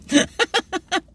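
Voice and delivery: high burst of real laughter; helpless high laugh. Real, not acted. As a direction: crack up after the punchline. Laughs after the punchline.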